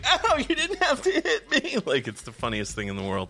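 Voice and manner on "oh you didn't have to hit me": very high wailing dog voice; whining hurt dog. Retold or imitated. Smacked dog complaining to the cat, high mock whine. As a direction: whimper it in a squeaky, wounded pitch. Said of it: "that's when he's like".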